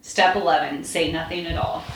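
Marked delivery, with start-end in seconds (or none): none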